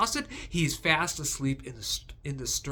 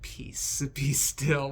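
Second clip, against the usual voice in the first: gentle and calming; slower than usual. Shown as a demonstration. A gentle translation of Jesus calming the storm, soothing. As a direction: say it like a lullaby to the sea. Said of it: "sounds gentle and calming and soothing".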